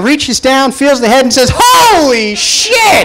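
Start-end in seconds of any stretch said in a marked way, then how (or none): 1.5-3.1 high stretched-out shout of shock, slow. Retold or imitated. Wife feeling the baby's head, shocked high shout. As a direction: let it burst out high and drawn out.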